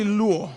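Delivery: "luo" with the accent on the u; stress on the U. Shown as a demonstration. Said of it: "con acento en la U".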